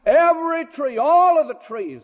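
high, expansive pulpit declaration; emphatic, high-pitched granting; God's generous proclamation. Retold or imitated. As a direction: ring out every word of the gift, high. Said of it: "God said to Adam".

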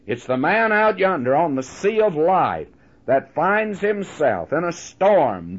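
ordinary delivery

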